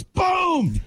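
sharp triumphant shout; shouted boom. Retold or imitated. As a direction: yell it as the putt drops. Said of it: "he goes".

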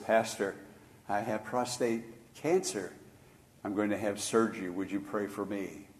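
soft, hushed plea to the pastor; low quiet voice. Retold or imitated. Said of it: "and whisper in a low voice".

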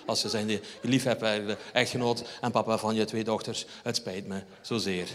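husband hamming up a soft, sincere apology to his wife; mock sincere apology. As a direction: grovel softly, slowing at the end.

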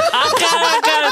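slow, high no-no through laughter; laughing refusal. Retold or imitated. Laughing refusal as the owner offers a sign, slow and high. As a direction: refuse while cracking up.

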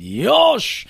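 high sharp "Yosh!" like a martial-arts master; master's cry. Retold or imitated. Bonsai master's sudden exclamation.

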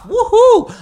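loud, high whoop; cheer. Real, not acted. Cheering at himself for not flinching, loud whoop. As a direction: whoop in triumph. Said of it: "cheering himself".

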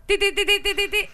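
high, loud mock news jingle. Announcing her scoop with a mock news jingle, high and loud. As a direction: chirp it like a breaking-news sting.

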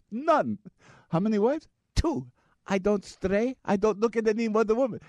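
high, emphatic Pakistani accent imitation. Driver defending his fidelity, in a mock Pakistani accent.

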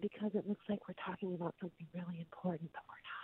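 barely audible, hurried whisper; re-enacted conspiratorial whisper, trailing to almost nothing. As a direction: whisper it fast, like a shared secret. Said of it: "why are we whispering".

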